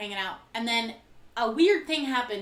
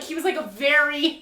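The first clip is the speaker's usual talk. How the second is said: ordinary delivery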